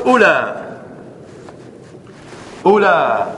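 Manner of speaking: "أولى" ending on open ulaa, no glottal stop; shortened form, no hamza. Shown as a demonstration. Teacher showing the Tamimi form, without hamza. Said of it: "من قصر".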